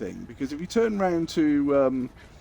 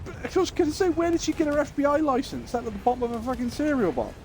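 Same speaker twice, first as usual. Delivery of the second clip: much higher, mocking disbelief; incredulous rant; 11 semitones higher than usual. Real, not acted. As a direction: scoff at her FBI license. Incredulous rant about license.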